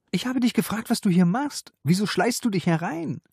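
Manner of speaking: very high indignant questioning; heated high-pitched confrontation; angry. A narrator's reading. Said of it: "Wütend riss sie die Tür".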